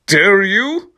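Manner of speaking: a sudden high, loud "dare you?"; mock outrage. Teacher demanding an answer from the students, mock outrage. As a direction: fling the question up in outrage. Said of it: "with a question mark".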